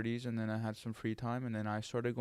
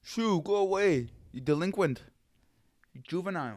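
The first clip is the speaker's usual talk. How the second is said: high, loud shooing scold; scolding parent; 6 semitones higher, 4 dB louder than usual. Pretend. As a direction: shoo the kid off like an angry neighbor. Acting scolding parent shooing kid.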